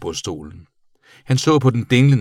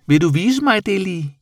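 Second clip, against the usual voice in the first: high, louder creature voice; eager bat; 12 semitones higher, 5 dB louder than usual. A narrator's reading. Bat asking the boy to show her, high and eager. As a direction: plead high-pitched.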